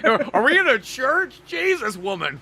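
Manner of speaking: very high, loud incredulous squawk; mock shock. Man waking beside a woman, mock-shocked. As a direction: act out exaggerated dismay, voice shooting up. Mock outrage in acted scene.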